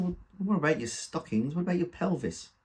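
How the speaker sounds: exasperated concern. Acted. Son alarmed after mother's fall.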